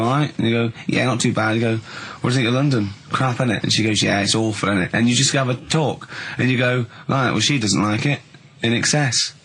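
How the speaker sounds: normal talking voice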